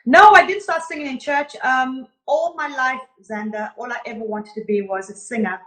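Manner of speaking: normal talking voice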